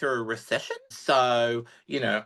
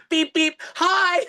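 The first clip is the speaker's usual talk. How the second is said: mock car horn; 13 semitones higher, 5 dB louder, much slower than usual. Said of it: "and went".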